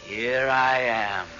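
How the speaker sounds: slow, distant, hollow greeting from outside; ghostly call. Acted. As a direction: call her name from beyond, drawn out. Ghost at the window.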